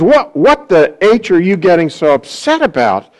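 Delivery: normal talking voice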